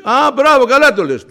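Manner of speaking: high, loud royal approval; king to Haman, loud and high; pleased king. Acted. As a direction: praise him, then spring the trap.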